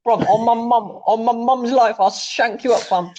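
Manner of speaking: high-pitched mock street threat; put-on London roadman, pitched right up; roadman impression. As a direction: swear it like a roadman. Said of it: "they all sound like roadmen".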